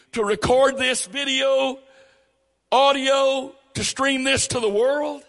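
loud, high preaching voice; full-force preaching. Real, not acted. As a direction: preach it hard to the crowd.

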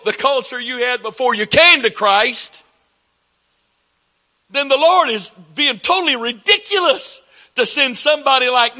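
high-pitched, insistent sermon voice; emphatic preaching. Real, not acted. Preacher arguing God's choice to the church, emphatic preaching.

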